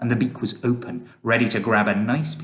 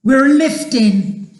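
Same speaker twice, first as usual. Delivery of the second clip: high, excited slow yell; stretched, high-pitched cry; shouting; 14 semitones higher, 10 dB louder, slower than usual. A narrator's reading. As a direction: shout the news out loud. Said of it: "somebody shouted".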